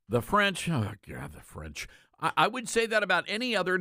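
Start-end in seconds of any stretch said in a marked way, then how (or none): 0.7-1.9 dropped low and much softer; dismayed aside. Real, not acted. Host reacting to naming the French, dismayed low aside. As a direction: let it sink away under your breath.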